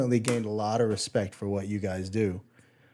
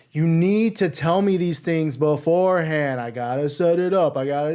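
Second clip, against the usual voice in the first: mock scolding, raised and drawn out; imitated yelling; 5 semitones higher, 4 dB louder than usual. Sound guy scolding musician over acoustic set, imitated yelling. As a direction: imitate a telling-off, louder and slower at first. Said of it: "he was like, yelling at me".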